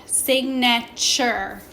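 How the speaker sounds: SIGNATURE slowly as sig-nuh-chur; ch sound. Shown as a demonstration. Teacher shows the ch in signature, slowly sounded out. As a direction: sound out "signature" in slow syllables. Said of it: "making that ch".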